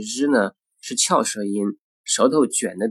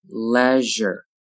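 "Leisure" slowly with a held zh; slow careful "Leisure"; drawn-out model. Shown as a demonstration. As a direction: stretch "Leisure" out for learners. Teacher models word slowly, then normally.

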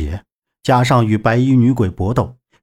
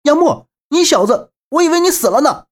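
high-pitched, thrilled outburst; high, delighted exclamation; excited cry; 14 semitones higher than usual. A narrator's reading. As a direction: cry out in joy at a friend you thought dead. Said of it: "兴奋地拉开铁门，一把抱住杨木，大呼道".

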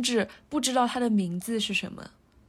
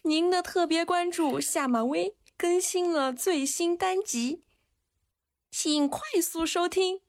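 notification voice; 7 semitones higher, slower than usual. Pretend. Reads the phone notification aloud.

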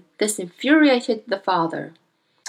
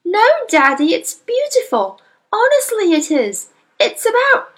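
put-on much higher, louder girl's voice, eager; pleading little girl; 13 semitones higher, 5 dB louder than usual. Young girl pleading with her father about the book. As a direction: beg him to listen, cut off.

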